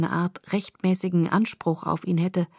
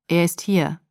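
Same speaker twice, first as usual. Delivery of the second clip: a lightly voiced toneless father. Billy answers worried Bella, toneless. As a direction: say it with no inflection at all. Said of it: "sagte Billy tonlos".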